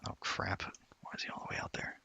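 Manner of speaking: hushed under the breath; whispering. Real, not acted. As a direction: whisper it to yourself. Quiet aside while sneaking in game.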